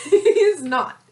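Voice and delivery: insisting. Real, not acted. Denying friend's teasing.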